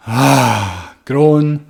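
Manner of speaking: loud audible groan on the exhale; demo groaning sigh. Shown as a demonstration. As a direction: let out a heavy groaning sigh. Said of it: "And then sigh".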